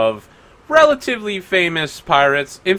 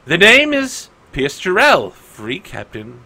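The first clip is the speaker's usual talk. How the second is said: pirate captain; 3 semitones higher than usual. Acted. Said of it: "He's like".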